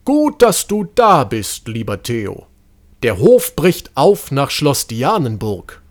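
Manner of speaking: put-on old doctor hurrying up, eager and urgent; excited. A narrator's reading. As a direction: physician rushing to meet the boy with news. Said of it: "lief ihm aufgeregt entgegen".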